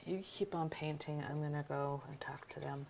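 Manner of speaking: soft whisper to her partner. Real, not acted. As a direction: whisper so the viewers don't hear. Whispering before switching to camera.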